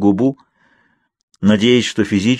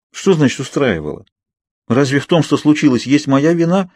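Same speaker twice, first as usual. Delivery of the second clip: higher, offended protest; 5 semitones higher than usual. A narrator's reading. Heroine offended by Edward's teasing, higher hurt protest. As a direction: bristle at the accusation. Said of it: "обиженно спросила я".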